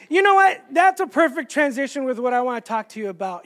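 normal talking voice

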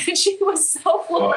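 high-pitched, laughing delivery. Real, not acted. Storyteller amused by the woman's astonishment, laughing. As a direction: tell it through rising laughter. Laughing while telling the punchline.

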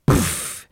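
voiceless puff of air; imitated poof. Storyteller mimics the line giving way, voiceless poof. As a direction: blow the word out as a sound effect.

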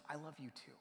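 very quiet, almost swallowed answer; soft tender reply. Retold or imitated. Ill grandmother answering the mother, very softly. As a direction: give the words back softly.

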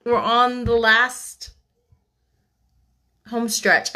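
slow, stretched emphasis on last; drawn out. Real, not acted. Reader telling listeners the book is almost done, drawn out. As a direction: savor the words slowly. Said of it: "I almost said".

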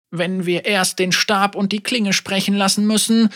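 a hint of a grieving elder speaking frankly; old woman Ying. Elder Ying tells Yasuo she holds a grudge, gently voiced.

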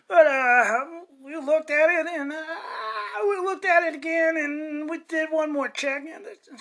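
nervous lawyer. Acted. Lawyer voice delivering bad news.

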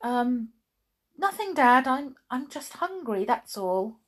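caught out. A narrator's reading. Chloe caught out by Dad.

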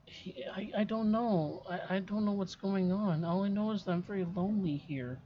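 put-on high, frightened ghost child; scared little girl.

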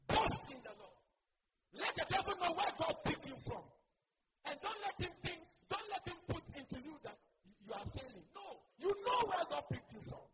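rising, fervent exhortation; full-force preaching. Acted. Preacher urging the church to boast in God, fervent. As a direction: build it up and drive it home.

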